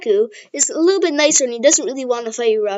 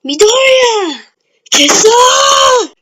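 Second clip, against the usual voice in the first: much higher, louder yell of frustration; frustrated shout; 11 semitones higher, 6 dB louder, much slower than usual. Retold or imitated. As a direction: yell the name, then curse in frustration. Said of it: "I should probably would have said".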